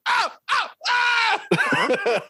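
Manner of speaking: very high comic screaming; Sam Kinison scream. Retold or imitated. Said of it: "pulled the Sam Kinison".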